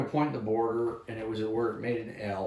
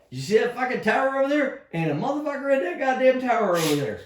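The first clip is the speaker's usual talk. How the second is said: high, loud cursing shout; angry shout; 12 semitones higher, 5 dB louder than usual. Retold or imitated. Soldier yelling at his buddy about the empty tower, high angry shout. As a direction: yell it at the buddy who won't cross. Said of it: "and I was like".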